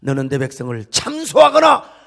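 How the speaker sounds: loud commanding rebuke, rising in pitch; preacher declaiming God's command, building to a shout; solemn rebuke. Acted. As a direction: pronounce a forceful ban on the accuser. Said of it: "이렇게 책망해 주시기를 바랍니다".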